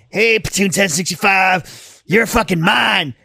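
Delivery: mock drill-instructor call, long drawn-out words; slow, stretched-out imitation of a drill instructor; drill instructor yell. Storyteller voicing instructor claiming passing recruits, drawn-out mock yell.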